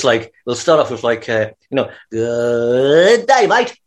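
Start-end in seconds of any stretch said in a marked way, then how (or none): 2.1-3.8 Australian drawl like a record slowing down; slurred slow-starting Australian accent. Shown as a demonstration. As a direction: drag out an Australian greeting, then lift the end. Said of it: "almost like slowing down a record".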